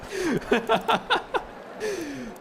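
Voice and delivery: high-pitched burst of laughter. Real, not acted. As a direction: crack up laughing. Laughing at the stream start.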